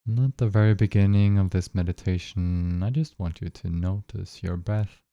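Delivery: calm, even guiding voice with long pauses; unhurried phrases, spaced out with long silences; guided meditation. Acted. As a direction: lead listeners gently into noticing their breath.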